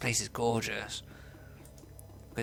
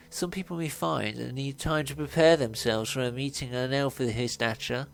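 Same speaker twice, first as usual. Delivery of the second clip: hammed-up, higher Victorian butler voice; fussy butler accent; 3 semitones higher, 7 dB louder than usual. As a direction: overdo the servile elf butler, close to cracking up. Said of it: "doing that stupid accent".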